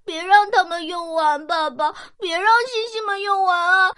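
put-on a little child pleading through tears; crying plea. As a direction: beg Daddy not to let the stars run out, crying. Child begs father, frightened.

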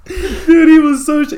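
very high, loud, squeezed by laughter; helpless real laughter; laughing. Real, not acted. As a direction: barely get it out for laughing. Cracking up at own story.

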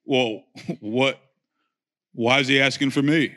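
low, calm tough-guy voice; John Wayne mode. Retold or imitated. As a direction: stay cool like a cowboy hero. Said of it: "I wish my voice went John Wayne mode".

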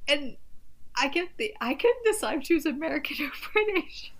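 real giggles breaking into speech; laughing. Real, not acted. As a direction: barely get it out for laughing. Cracking up at the bad accent.

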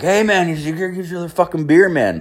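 imitating the bar owner handing over a beer. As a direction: play the hearty owner greeting you.

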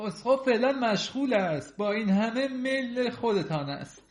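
high, loud priest voice with doubt; doubtful priest, pitched well up; hesitant priest. A narrator's reading. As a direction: raise the voice, reluctant to agree. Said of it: "کشیش با لحن تردیدآمیزی گفت".

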